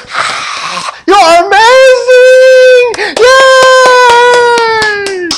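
very high, loud, drawn-out crowd cheer. Retold or imitated. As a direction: squeal like an awestruck crowd.

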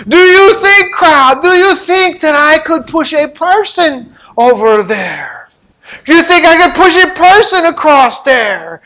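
high, loud showman challenge; showman calling out. Retold or imitated. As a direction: tease the crowd with a big dare. Blondin challenging crowd.

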